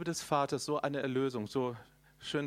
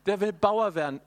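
excited; 5 semitones higher, 8 dB louder than usual. Retold or imitated. Retelling son's wish.

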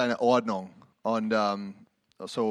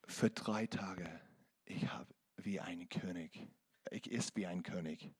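whispered gratitude; 5 semitones lower, 12 dB quieter than usual. Retold or imitated. Quotes grateful poor man.

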